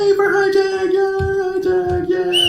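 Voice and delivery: high, drawn-out cheer. Real, not acted. Host welcomes [name] up to perform, cheering. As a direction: whoop the next act onstage.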